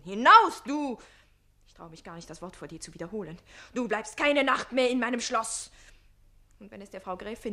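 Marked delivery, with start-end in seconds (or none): 0.0-1.0 loud, high shouted order; retold shout. Page retelling the count's order, shouted. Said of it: "hat er geschrien". 1.7-3.4 softer, quick embarrassed aside; sheepish. Acted. Page to Susanne, quick sheepish murmur. Ashamed to repeat the word. 3.7-5.7 louder stern order; mild mock command. Page quoting the count, mild stern command. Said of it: "hat er geschrien".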